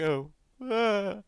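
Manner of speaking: mock crying wail. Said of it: "She's like".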